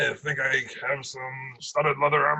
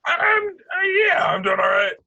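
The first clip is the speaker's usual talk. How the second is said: calling out; 6 dB louder than usual. Acted. Shopkeeper calls from back room.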